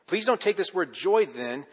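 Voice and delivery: usual speaking voice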